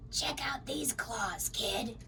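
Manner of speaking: slow, rasping monster whisper; rasped whisper. A narrator's reading. Monster under the bed rasps at the kid, slow whisper. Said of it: "a third voice from under the bed rasped".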